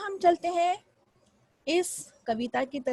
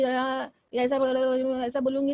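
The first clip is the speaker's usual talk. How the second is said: monotone demo. Shown as a demonstration. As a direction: show how dull flat speech sounds. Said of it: "अभी जैसे मैं आपसे बात कर रही हूँ, जैसे अगर समझो बोलूँगी".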